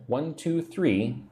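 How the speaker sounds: normal talking voice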